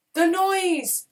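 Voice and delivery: high, loud frustrated shout. A narrator's reading. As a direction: shout it over the din. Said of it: "Crom shouted".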